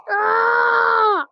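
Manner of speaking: loud high yelp of alarm; sudden cry of alarm; short scream. Real, not acted. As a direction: let out a quick startled cry.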